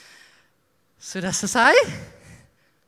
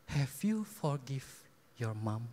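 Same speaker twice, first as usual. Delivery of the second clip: softer, slow and tender question; gentle emphasis; 6 semitones lower, 7 dB quieter, slower than usual. Retold or imitated. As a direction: ask it gently, like God coaxing. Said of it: "aku cuman penekanan kayak gitu". (pertanyaan lembut dan pelan)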